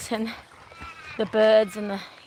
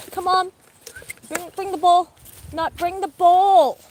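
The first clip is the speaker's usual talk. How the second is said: coaxing dog voice; 8 semitones higher, 4 dB louder than usual. Real, not acted. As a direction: coax the dog to bring the ball, high.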